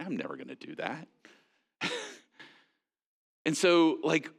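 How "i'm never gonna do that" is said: muttered inner aside, softer and lower; inner thought. Retold or imitated. Preacher voicing the listener's private refusal, muttered. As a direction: say it under your breath, quietly. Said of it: "in the back of your mind".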